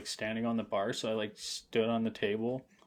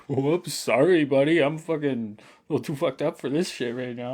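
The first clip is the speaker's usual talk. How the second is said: loud, sloppy sorry buddy; loud drunk apology; 3 semitones higher, 7 dB louder than usual. Retold or imitated. Apologizing to the stranger, loud tipsy sorry. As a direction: blurt out an apology, half laughing.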